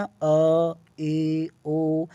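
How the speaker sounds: अ, ए, ओ held evenly, mouth half open; half-open vowels. Shown as a demonstration. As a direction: sustain each vowel on a level note. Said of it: "half open हो रहा है". (आधे खुले मुंह से स्थिर स्वर)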